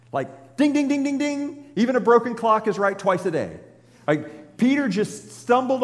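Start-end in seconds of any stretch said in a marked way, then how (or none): 0.1-1.6 high game-show ding; mock ding bell. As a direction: ring it like a quiz show bell.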